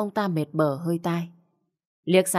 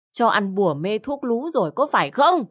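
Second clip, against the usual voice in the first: higher, rising irate demand; angry accusation; 4 semitones higher than usual. Acted. Mother accusing son of being bewitched, angry. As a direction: fling the question at him, climbing in pitch.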